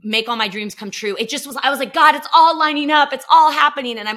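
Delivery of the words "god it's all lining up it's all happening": excited. Retold or imitated. Retelling her own excitement.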